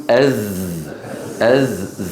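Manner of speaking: أز with a voiced, buzzing zay; buzzing ز demo. Shown as a demonstration. Teacher showing students the whistle of the zay, buzzing. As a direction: let the zay of أز buzz. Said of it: "لما يقول".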